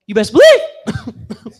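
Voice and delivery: slow line that breaks up high; voice cracking. Retold or imitated. Boyfriend going for the winning line in an argument, voice cracking up high. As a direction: let the voice crack on the knockout line. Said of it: "I was like".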